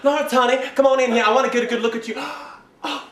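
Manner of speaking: loud Southern mama calling her son in; gushing mother. Acted. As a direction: fuss loudly over your boy. Mother calls son in for inspection.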